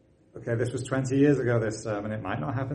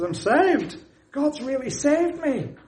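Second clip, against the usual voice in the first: joyful exclamation; 14 semitones higher, slower than usual. Retold or imitated. Convert telling the preacher he is saved, high joyful cry. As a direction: burst out with the news of salvation.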